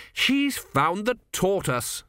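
lightly voiced sulky Zeno; sulky little man. A narrator's reading.

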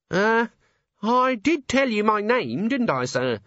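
put-on high, hesitant young dwarf; nervous high voice. A narrator's reading. Nervous dwarf Cheery speaks.

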